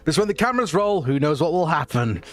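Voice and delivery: a theatrical host voice, slowing down on the final warning; Victor's showman voice. Acted. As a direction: play the slick director presenting his stars. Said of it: "Victor kind of looks over and goes".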